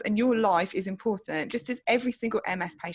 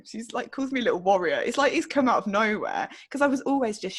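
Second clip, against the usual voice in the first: usual speaking voice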